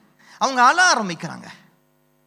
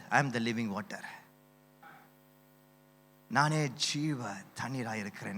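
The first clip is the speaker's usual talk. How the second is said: much softer and lower, hushed reverence; soft, reverent quote; 9 semitones lower, 11 dB quieter than usual. Retold or imitated. Preacher quoting Jesus to the congregation, soft and reverent. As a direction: say it gently, almost hushed. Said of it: "Jesus said".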